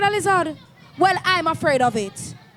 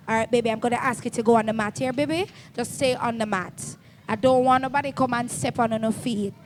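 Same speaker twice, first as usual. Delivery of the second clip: soft reassuring; 5 semitones lower, 5 dB quieter, faster than usual. Real, not acted. Soothing a small child.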